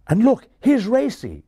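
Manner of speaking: loud, high pointing-out; excited announcement. Acted. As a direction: call out the arrival eagerly. Larry points out a new arrival.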